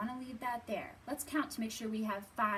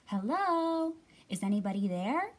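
shouted call; 8 dB louder than usual. A narrator's reading. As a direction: call out into a big hole. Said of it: "she shouted".

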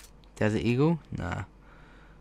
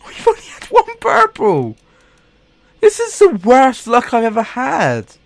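very high squeaky whining complaint; squeaky frustrated whine; 8 dB louder than usual. Real, not acted. Losing case openings, complaining in a very high squeaky whine. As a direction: whine in a tiny high voice at the bad luck.

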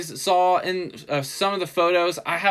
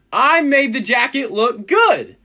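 excited; 6 semitones higher, 5 dB louder than usual. Real, not acted. Excited about the good shot.

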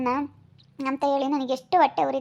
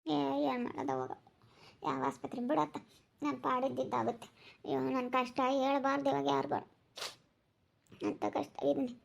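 tearful lament; 7 dB quieter than usual. Acted. Woman lamenting her troubles.

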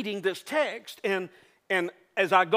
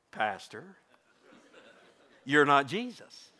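congregant's voice; 6 semitones lower, 4 dB quieter, slower than usual. Retold or imitated. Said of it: "I know what you're saying".

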